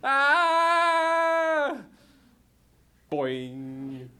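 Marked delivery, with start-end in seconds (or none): 0.1-1.8 long high loud scream. Acted. Characters screaming as van flies, loud scream. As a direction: scream in terror.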